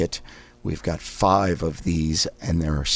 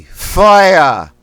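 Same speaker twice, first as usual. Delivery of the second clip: Boston accent; 11 semitones higher, 12 dB louder than usual. Shown as a demonstration. Coach demonstrating Boston vowel drill, loud thrust-out Boston Fire. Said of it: "We say fire like a Boston accent".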